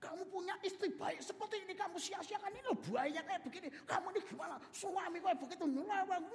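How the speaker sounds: high, scolding judge's voice. Acted. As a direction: tell the husband off from the bench. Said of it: "hakimnya marah-marah".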